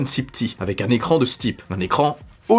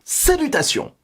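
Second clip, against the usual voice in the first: Salutations pitched much higher, bright and punchy; dynamic greeting; 11 semitones higher than usual. Shown as a demonstration. YouTuber redoing his intro, dynamic greeting. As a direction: launch Salutations high with energy. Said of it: "Non, c'est pas assez dynamique".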